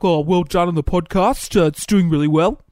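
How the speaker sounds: loud imitation of the company head; lightly voiced tough-guy boss; tough boss impression. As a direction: mimic a scary boss giving praise. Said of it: "That's how he sounds".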